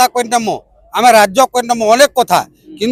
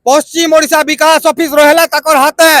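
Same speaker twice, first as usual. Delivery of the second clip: rhythmic, ringing verse delivery; protest poem recitation; 5 semitones higher than usual. Acted. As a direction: recite it like a rallying verse for a crowd. (ପ୍ରତିବାଦୀ କବିତା ଆବୃତ୍ତି)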